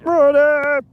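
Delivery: high yelled Brody; mock quarterback yell. Imitating a quarterback yelling the snap cadence, high mock yell. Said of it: "when he's yelling".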